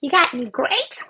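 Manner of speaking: cartoon duck voice. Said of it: "the dog walked up to the lemonade stand and said".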